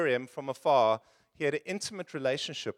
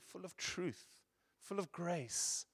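hushed awe; 14 dB quieter, slower than usual. Real, not acted. Hushed awe at Jesus' glory.

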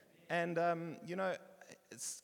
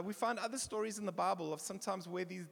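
ordinary talk, nothing marked

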